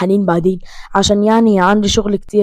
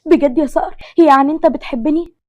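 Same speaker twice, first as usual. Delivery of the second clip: high, louder eager question; hopeful, eager; 5 semitones higher, 6 dB louder than usual. A narrator's reading. Haneen holding Saqr's hand asks about love, hopeful and eager. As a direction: ask with rising eager hope. Hopeful asking whether he loves her.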